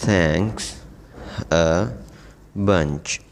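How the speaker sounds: thanks a bunch said word by word; slow drill for learners; slow repeat-after-me drill; slow model reading. Shown as a demonstration. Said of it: "چوتھا".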